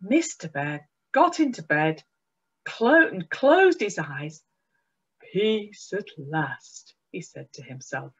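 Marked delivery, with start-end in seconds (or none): 5.3-6.9 slow, soft, contented sigh of relief; sleepy relief. A narrator's reading. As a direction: let out a tired, relieved murmur. Mister Bear relieved in bed.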